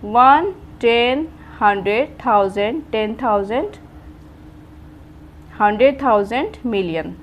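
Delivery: slow, deliberate repeat. Shown as a demonstration. Teacher repeating वन, टेन slowly for students. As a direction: spell out वन, टेन slowly for the class. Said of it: "Once again, I repeat".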